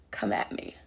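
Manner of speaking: breathy whisper, tossed off; a quick whispered plea not to get attacked; whispered aside. Real, not acted. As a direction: whisper it like a private joke. Aside before critics.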